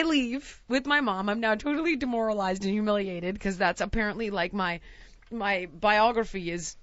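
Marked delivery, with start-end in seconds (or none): none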